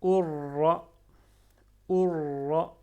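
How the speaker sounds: loud Urra with a long rolled r; doubled r in Urra. Shown as a demonstration. Teacher showing shadda, Urra with a doubled r. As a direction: lean hard on the trill in Urra. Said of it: "Avec shadda".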